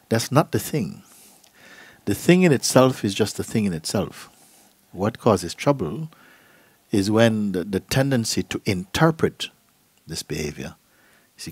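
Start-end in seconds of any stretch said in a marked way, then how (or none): none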